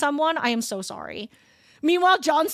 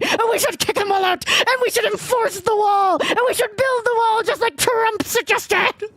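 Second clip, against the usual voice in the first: hammed-up preacher ranting louder and louder; mock preacher turning to rant; 5 semitones higher, 4 dB louder than usual. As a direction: let the mock pastor work himself up, building to a loud rant. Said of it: "imagine being like".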